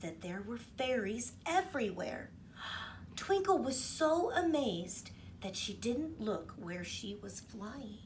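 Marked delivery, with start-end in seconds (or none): none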